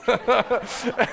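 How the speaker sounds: short high giggle; real laugh. Real, not acted. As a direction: burst out laughing mid-story. Laughing at own story.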